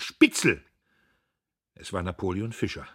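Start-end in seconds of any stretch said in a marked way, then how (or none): 0.0-0.6 loud, high, sudden shriek; screech. A narrator's reading. Someone behind Dietrich accuses him, screeching. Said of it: "kreischte jemand".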